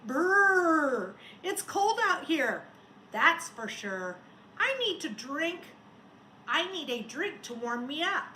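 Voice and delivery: high, loud shivery brrr of a cold snowman; shivering snowman. A narrator's reading. Teacher reading Sneezy to the class, shivering and high. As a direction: shiver through it like a freezing snowman. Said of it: "Sneezy the snowman shivered".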